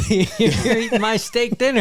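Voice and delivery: high, laughing fake indignation; mock outrage. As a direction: accuse the diner in jest.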